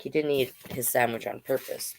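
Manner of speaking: usual speaking voice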